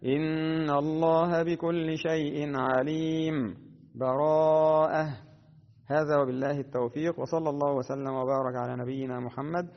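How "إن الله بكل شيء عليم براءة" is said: slow chant, pause and breathe before براءة; full stop with breath. Shown as a demonstration. Teacher demonstrates waqf: stop and breathe, then براءة. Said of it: "ويكون معه النفس هكذا".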